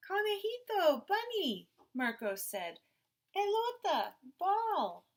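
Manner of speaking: put-on high, loud little-boy naming words; eager toddler words. A narrator's reading. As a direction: say it like a tiny child showing his toys. Toddler naming his toys.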